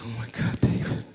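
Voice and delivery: soft, breathless mock weeping; quietly sobbed words, acted; imitated crying. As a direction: play a wife too tearful to speak up. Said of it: "And she's crying".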